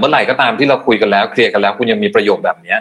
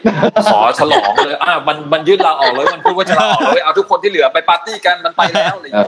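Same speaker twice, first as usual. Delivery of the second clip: high, laughing mock cheer of the boss; gleeful boss cheering the quitter, laughing; mock celebration; 8 semitones higher than usual. (เลียนเสียงเจ้านายดีใจ หัวเราะ)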